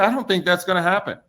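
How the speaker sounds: lightly put-on skeptical woman; imitating counselor. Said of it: "She said".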